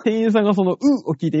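usual speaking voice

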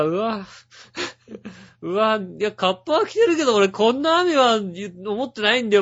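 high, loud protest at the rain; recoil, then complain in mock dismay; retold dismay. Man opening his door onto heavy rain, retold dismay.

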